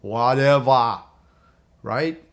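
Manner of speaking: slow, loud action-hero whatever; Arnold Schwarzenegger. Shown as a demonstration. Coach shows the password in a slow, loud action-hero voice. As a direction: drop it slow and loud like a tough action star. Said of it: "Pretend you're Arnold Schwarzenegger".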